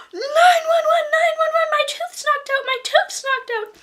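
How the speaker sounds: very high, very loud screaming cry; gasp, then scream for help like an emergency; a girl shrieking nine one one out the front door; frantic shout. A narrator's reading. Kid yells for help at door.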